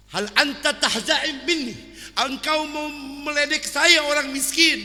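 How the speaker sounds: a proud poor man who thinks he is mocked; indignant poor sheikh.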